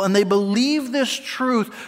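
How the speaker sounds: ordinary delivery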